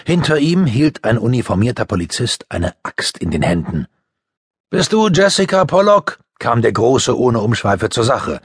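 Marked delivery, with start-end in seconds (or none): 4.7-6.2 higher, louder gruff policeman voice; a cop getting straight to business; big policeman. A narrator's reading. The big detective questions Jessica, loud and higher.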